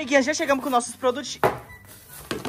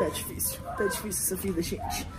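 breathless whisper; 3 semitones lower, 8 dB quieter than usual. Real, not acted.

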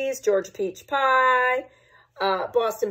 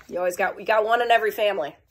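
ordinary delivery, nothing marked

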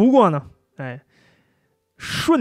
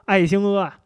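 usual speaking voice

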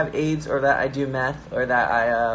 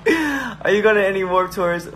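usual speaking voice

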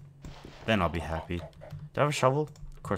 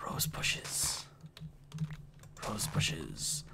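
awed whisper; 11 dB quieter, much slower than usual. Real, not acted. Awed discovery of rose bushes.

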